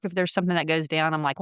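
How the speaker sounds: normal talking voice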